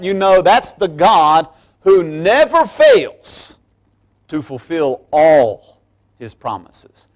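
loud, slow, rising preacher's build; fervent sermon climax; preaching at full force. Acted. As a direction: drive the promise home from the pulpit.

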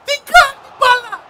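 high, loud cry of disbelief; stunned shout. Real, not acted. Gamer yelling in disbelief at the ball. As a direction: scream it at the screen.

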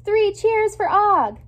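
high, loud, slowed shout of cheers; rally the class with a big cheer. A narrator's reading. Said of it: "A J loudly shouts".

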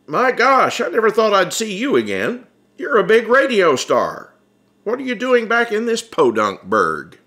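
a working man bowled over by a famous friend; Bud amazed. Acted.

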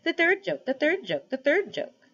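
a thrilled bird crowing the same words thrice; excited jackdaw. A narrator's reading. Jackdaw gleefully calling out to the animals, excited. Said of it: "said the jackdaw in great excitement".

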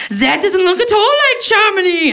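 put-on high, sour old lady voice; grandma. German grandma at Epcot unimpressed, high and sour. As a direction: grumble disapproval in a high old voice. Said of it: "she goes".

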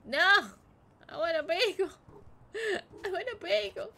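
loud high whiny wail; hammed-up child tantrum; mock tantrum. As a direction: cry like a spoiled child.

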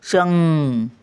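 "शं-," held on a humming "ng"; ङ चा उच्चार. Shown as a demonstration. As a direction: stop on shang and let the nasal ring. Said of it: "ngचा उच्चार". ("शं-," ङ लांबवून)

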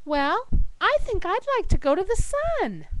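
high-pitched hammed-up airhead; mock-dim, high voice; ditzy blonde. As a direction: pipe up proudly with a silly idea. Dim blonde gives her answer.

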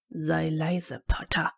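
low, hushed reprimand; hissed. A narrator's reading. Snape shushes Potter's outburst, low hiss. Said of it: "zischte Snape".

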